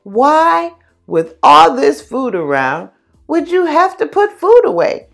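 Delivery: high, loud teasing bug voice; carefree grasshopper. Acted. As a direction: scoff like a lazy, happy grasshopper. Grasshopper scoffs at ant.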